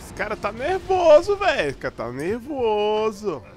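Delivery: high panicked screaming; being chased. Real, not acted. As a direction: yell for your life, fleeing. Chased by angry players. (gritando em pânico, fugindo)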